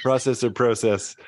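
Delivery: "process or process" with a laugh, first PRAH-sess, then PROH-sess; both pronunciations. Shown as a demonstration. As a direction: laughingly contrast American "process" with British "process". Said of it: "saying process".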